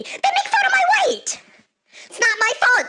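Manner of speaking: high, loud outraged shriek; indignant screech. Acted. Woman protesting jokes about her weight, indignant screech. Indignant retelling of being mocked.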